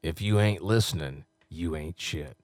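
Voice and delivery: low, punchy promo read; low-pitched radio imaging voice; radio announcer. Acted. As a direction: deliver it like a station liner. Records promo liners in announcer voice.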